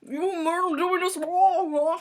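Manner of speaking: high, loud muffled protest; slow, garbled mock mouthful; mouth crammed full. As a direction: talk through stuffed cheeks. Friend talking with cheeks stuffed.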